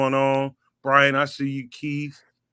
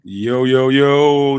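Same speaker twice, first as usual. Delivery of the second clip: hyped shout; 9 dB louder, much slower than usual. Real, not acted. Hyped greeting to co-host.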